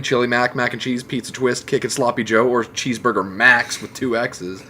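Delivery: usual speaking voice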